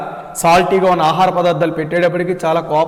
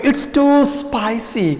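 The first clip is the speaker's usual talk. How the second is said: courtier complaining; 6 semitones higher, much slower than usual. Acted. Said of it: "Courtier one".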